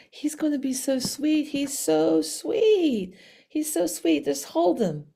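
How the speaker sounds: imitating a friend melting over a kitty; cooing coaxing. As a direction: coo it as a sweet plea.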